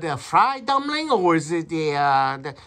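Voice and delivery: customer's put-on Chinese accent. Customer phoning restaurant to order, in a Chinese accent. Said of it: "we're gonna do a Chinese accent".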